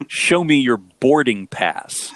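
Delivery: each word drawn out so it cannot be misheard; slow, clear correction. Retold or imitated. Wife explaining what the guy said, slow and clear. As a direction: slowly enunciate BOARDING PASS. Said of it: "that's what the guy said to you at the gate".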